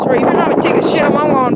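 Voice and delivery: normal talking voice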